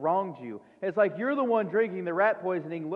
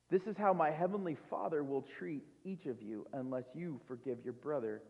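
ordinary talk, nothing marked

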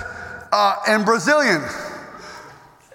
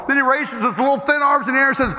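ordinary talk, nothing marked

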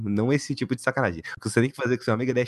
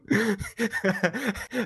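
high laugh; 9 semitones higher, 4 dB quieter than usual. Real, not acted. Reacting to the silly plot.

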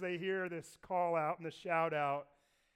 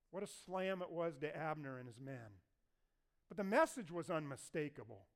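ordinary talk, nothing marked

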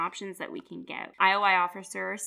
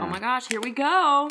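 excited planning; 5 semitones higher, 8 dB louder than usual. Real, not acted. About to open the packs.

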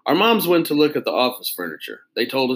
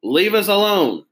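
shouted; 8 semitones higher, 5 dB louder than usual. Acted. Arlo shouting at salesman.

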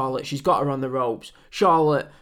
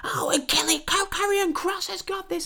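breathy, much higher mock hype call; mocking Mauro Ranallo. Podcaster mocking the commentator's constant hype, whispered high parody. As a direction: lampoon an overexcited commentator. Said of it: "He's screaming, he's shouting".

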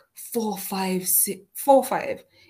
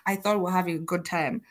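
ordinary delivery

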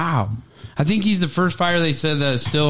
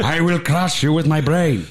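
Megamind voice; 3 semitones higher than usual. Retold or imitated. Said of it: "She's like".

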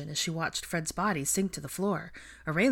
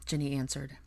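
plain reading voice

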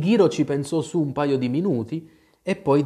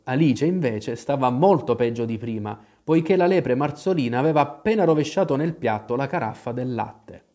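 ordinary delivery

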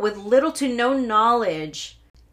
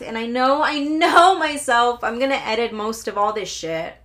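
normal talking voice

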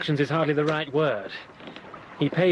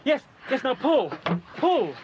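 straining effort; 7 semitones higher than usual. Acted. Hauling a body from water.

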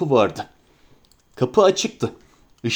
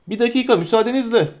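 called over the shoulder; 6 semitones higher, faster than usual. Acted. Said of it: "diye bağırdı adam".

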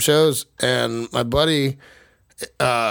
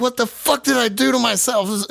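panicked regret; 8 semitones higher than usual. Retold or imitated. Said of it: "I was like".

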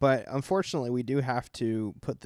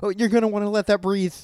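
lifted, louder, fussy and tense; mock high-strung Hooper; 8 semitones higher, 6 dB louder than usual. Friend doing Hooper's fussy wine line, higher and louder. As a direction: fuss over the wine like an uptight know-it-all. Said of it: "Hooper's like".

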